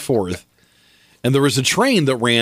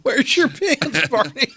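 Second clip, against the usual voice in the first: laughing high, slow repeat; 13 semitones higher, slower than usual. Pretend. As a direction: giggle through the line.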